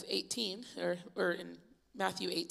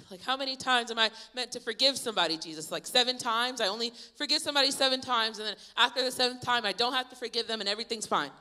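fast, loud, higher-pitched rattle; hurried, raised-voice acted protest; insistent questioning; 5 semitones higher, 5 dB louder, faster than usual. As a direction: rush through your reasoning like an overeager pupil. Acting out Peter pressing his question.

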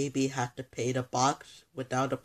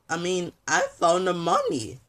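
ordinary delivery

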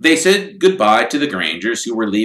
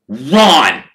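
a sharp, louder cry to stop a friend; urgent call; 5 semitones higher, 9 dB louder than usual. Acted. Friend grabbing Ron's jacket, shouting his name. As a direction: call out his name to hold him back.

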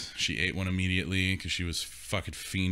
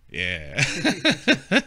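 stoned drawl; 7 semitones higher, 6 dB louder than usual. Retold or imitated. Stoned mom answering.

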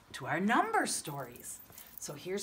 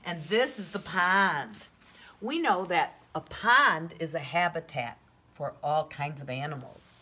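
usual speaking voice

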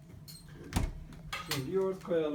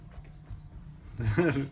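being tickled. Real, not acted. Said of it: "Gıdıklama beni".

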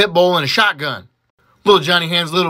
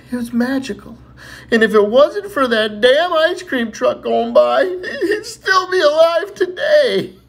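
put-on slow, higher old lady voice, laughing at the end; grandma's slow wistful story breaking into laughter; 6 semitones higher, 4 dB louder, slower than usual. Grandma tells tale slowly.

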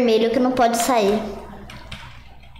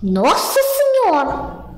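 amazed exclamation; 9 semitones higher, much slower than usual. Real, not acted. Exclaims at character.

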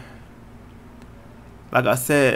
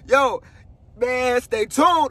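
slow, high, drawn-out shout; slow hyped-up yell; excited shout; 8 semitones higher, much slower than usual. Real, not acted. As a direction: stretch it out like a hype man.

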